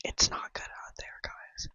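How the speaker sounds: hushed warning whisper. Real, not acted. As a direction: whisper it to the viewers. Unvoiced, very soft.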